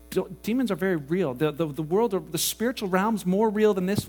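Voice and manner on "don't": usual speaking voice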